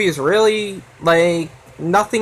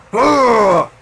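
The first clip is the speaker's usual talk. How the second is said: disgusted grunt; 5 semitones higher, 4 dB louder than usual. Retold or imitated. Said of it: "That's my impression of Cinema Sickness".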